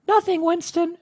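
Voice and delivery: high-voiced cartoonish reply; comic character voice. Reading comic dialogue as characters.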